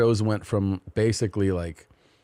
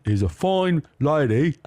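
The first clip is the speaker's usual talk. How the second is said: slow, mock cockney lady voice; hammed-up British gossip; fake English accent; 9 semitones higher than usual. Husband mocking the royals in a slow fake English accent. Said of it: "fake English accent".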